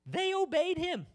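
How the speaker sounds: high, slow, incredulous exclamation; loud amazement. Acted. Preacher marveling that the demons obeyed, loud high amazement. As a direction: belt it out in astonishment, drawn out and pitched high.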